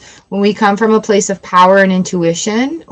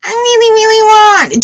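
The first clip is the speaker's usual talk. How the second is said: much higher, pleading voice; whiny wanting; 12 semitones higher, 3 dB louder than usual. Shown as a demonstration. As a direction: whine it like a needy kid. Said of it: "Do you see the difference there?".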